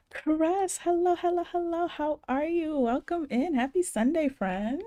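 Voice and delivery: high, bright sing-song hello; excited greeting. Real, not acted. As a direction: welcome a friend with a big smile. Greeting viewer in chat.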